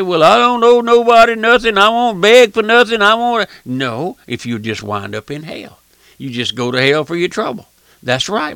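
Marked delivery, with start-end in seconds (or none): 0.0-3.5 higher, louder, proud refusal; stubborn mock defiance; defiant boast. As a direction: rear back like a proud sinner. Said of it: "rear back and say".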